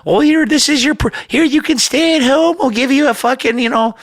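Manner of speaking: high, fast mock elite voice handing out favours. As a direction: sneer it as a patronizing official. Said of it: "they want us all like".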